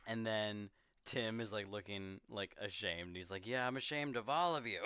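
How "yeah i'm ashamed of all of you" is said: laughing. Retold or imitated. Tim ashamed, laughing.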